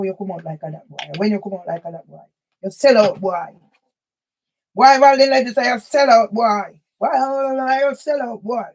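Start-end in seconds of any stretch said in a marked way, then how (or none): 2.6-8.6 loud scolding jeers; angry rant. Real, not acted. Scolding a man for selling out, loud jeering. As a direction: berate him, punch each sell out. Berating someone as sellout.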